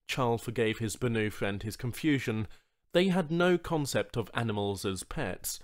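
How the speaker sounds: usual speaking voice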